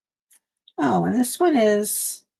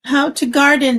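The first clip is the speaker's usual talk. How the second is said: excited greeting; 5 semitones higher, 6 dB louder than usual. Real, not acted. Greeting viewer by name again.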